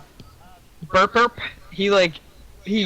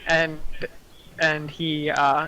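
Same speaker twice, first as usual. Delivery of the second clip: ordinary delivery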